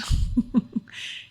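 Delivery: short, breathy laugh; apologetic chuckle. Real, not acted. Apologizing for carrying on, amused chuckle. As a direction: laugh off the interruption.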